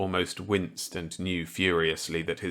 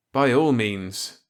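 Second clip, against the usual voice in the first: higher, louder character voice; eager reply to an old colleague; Walker's voice; 4 semitones higher, 6 dB louder, slower than usual. Acted. Walker accepting Millet's gambit eagerly.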